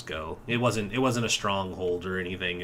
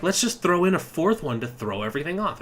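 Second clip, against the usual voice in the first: mocking quote; 8 semitones higher, 4 dB louder, faster than usual. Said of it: "They were just like".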